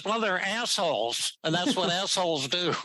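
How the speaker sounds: a light New Yorker's blunt put-down; a mild New York accent impression with a laugh; Albert Ellis New York twang. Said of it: "this is the way he talked".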